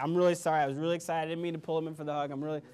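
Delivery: retold frantic apology to the boss; panicked apologizing. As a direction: gush out sorries in a nervous rush. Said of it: "I start apologizing".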